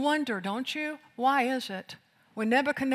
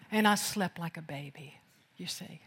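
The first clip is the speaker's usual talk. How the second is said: whispered, lower, softer; Daniel softly whispering to the king; hushed whisper; 5 semitones lower, 6 dB quieter than usual. Acted. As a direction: breathe it like a secret. Daniel tells Darius, hushed.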